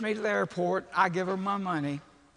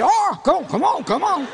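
exclaiming; 11 semitones higher, 12 dB louder than usual. Retold or imitated. Airport staff reacting to pants.

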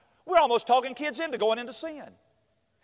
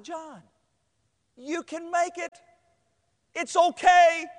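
high-pitched comforting, building louder; exaggerated reassurance; 8 semitones higher, 3 dB louder, much slower than usual. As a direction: start small and high, swell to a loud comfort. Coach comforting discouraged young man.

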